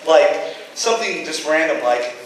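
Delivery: normal talking voice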